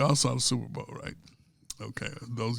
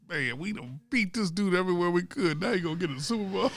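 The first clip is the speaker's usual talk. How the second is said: raised, fast-rushing exasperated quote; higher, hurried mock grumble; mock frustrated fans; 5 semitones higher than usual. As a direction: vent like a fed-up fan. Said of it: "so they was like".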